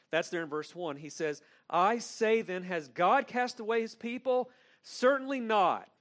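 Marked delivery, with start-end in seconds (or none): 1.7-5.9 loud, high, forceful rebuttal; preacher booms Paul's answer to the congregation, emphatic and loud; emphatic Paul quote. Retold or imitated. Preacher voicing Paul's denial to listeners, loud and emphatic. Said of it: "And Paul is very emphatic".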